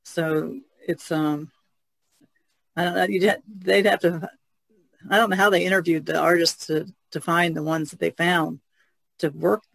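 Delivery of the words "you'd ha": usual speaking voice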